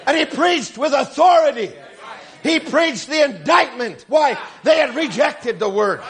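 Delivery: emphatic, punchy sermon delivery; forceful preaching. Real, not acted. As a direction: declare it with authority to the congregation.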